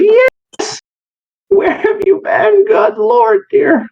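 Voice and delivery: very high, excited cries of relief; overjoyed shout. Acted. Owner reunited with his cat, squealing with joy.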